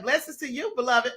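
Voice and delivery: ordinary talk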